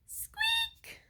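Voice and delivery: very high whispered squeak; a failed roar that squeaks; tiny squeak. A narrator's reading. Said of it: "all that came out with a squeak".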